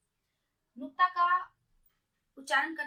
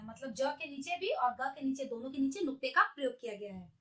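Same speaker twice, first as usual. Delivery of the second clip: ordinary talk, nothing marked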